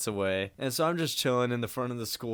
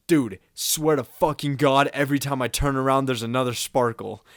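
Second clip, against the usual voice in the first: loud, raised-pitch imitation of a friend complaining; exasperated friend; 3 semitones higher, 7 dB louder than usual. Friend complaining about sparkles left in his house, loud exasperation.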